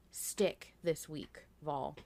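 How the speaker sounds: normal talking voice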